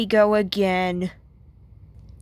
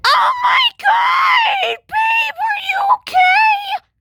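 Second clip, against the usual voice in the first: mock panicked shriek, much higher and louder; overdramatic worried boyfriend; 4 dB louder than usual. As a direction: squeal it like a boyfriend who's way too sensitive. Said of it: "he'll be like".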